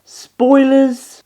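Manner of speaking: loud blurted reveal. Acted.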